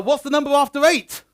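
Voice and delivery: loud, high question to the crowd; pantomime call-out. Pretend. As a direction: prompt the room to shout the answer.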